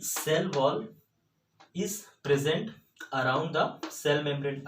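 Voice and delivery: words drawn out very slowly, one by one; slow dictation. Shown as a demonstration. Teacher dictating the textbook definition of Monera, very slow. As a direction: read it slowly so the class can write.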